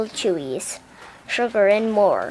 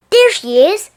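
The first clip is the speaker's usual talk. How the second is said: high, loud spotting call; excited shout; 9 semitones higher, 9 dB louder than usual. A narrator's reading. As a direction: call out as you spot her. Manager spotting Helen.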